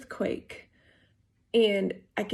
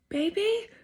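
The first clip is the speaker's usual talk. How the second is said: mild mock yell; 12 semitones higher than usual. Wife calling to her husband from the bathroom, mock yell. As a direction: call out high, a retold yell without the volume. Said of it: "and I yelled".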